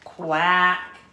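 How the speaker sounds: loud quacking duck voice; put-upon duck quacking. A narrator's reading. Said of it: "The duck answered".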